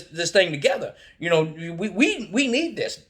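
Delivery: put-on a kid preaching perseverance to dad; pleading child lecture.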